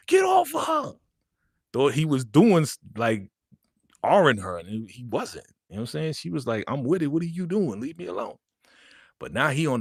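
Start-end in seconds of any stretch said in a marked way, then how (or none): 0.1-0.9 high, loud shout to stop an attacker; acted furious yell. 6.7-8.4 high-pitched imitation of a girl protesting; mock indignant protest. Acted protest at being interrupted.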